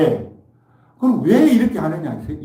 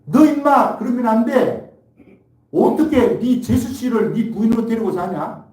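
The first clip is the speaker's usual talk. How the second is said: rebuke; 6 semitones higher, 3 dB louder than usual. Retold or imitated. Jesus rebuking Herod for his sin, stern and raised. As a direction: scold him like an angry elder. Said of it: "책망을 해야 돼요".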